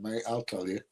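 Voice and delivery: ordinary delivery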